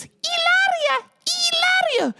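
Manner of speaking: high, slow, hammed-up Spanish pronunciation of "Hilaria" as "ee-LAH-ria"; mock Spanish name. Mocking how the wife says her own name, high fake accent. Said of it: "She calls herself".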